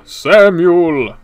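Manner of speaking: loud, high "Samuel"; mock calling out. Playfully calling a viewer's name, loud and high. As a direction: belt the name out long and playfully. Same name called louder, higher.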